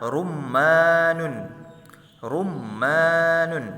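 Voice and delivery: rummanun drawn out slowly, each syllable clear; slow model pronunciation of rummanun for pupils; Arabic word said slowly. Shown as a demonstration. Teacher models rummanun slowly for pupils to repeat.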